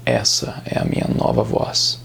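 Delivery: very deep, husky put-on tough-guy voice; mock action-series hero, low and rough; American action hero. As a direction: drop far down into a low action-protagonist growl. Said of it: "todos os protagonistas falam exatamente assim".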